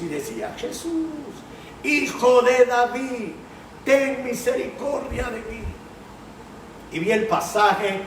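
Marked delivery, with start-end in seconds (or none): 0.6-5.6 high, pleading cry of a blind beggar; desperate cry. Retold or imitated. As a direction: call out to Jesus, higher, begging. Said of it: "venía gritando el nombre de Jesús". (grito suplicante y agudo)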